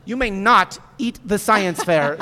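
loud, slow heroic command; commanding shout. Acted. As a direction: order the monster off firmly.

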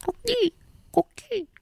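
sing-song coo to call the pet. Real, not acted. Calling a pet.

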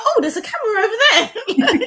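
high, laughing fake-startled line; mock surprise. Guest teasing the host by mimicking her startled outtake, high and laughing. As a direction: gasp it out as if you just spotted the lens. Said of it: "an outtake where you're like".

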